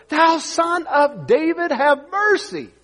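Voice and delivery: high, slow drawn-out plea; crying out harder. Acted. Blind man pleading over the rebuking crowd, crying out. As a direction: stretch the cry out, pitched high. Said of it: "he cried so much the more".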